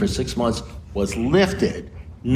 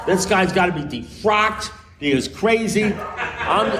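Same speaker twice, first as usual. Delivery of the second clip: raised pitch, indignant and incredulous; indignant rant; 7 semitones higher than usual. Retold or imitated. Lawyer ranting to us about the priest, pitched up and indignant.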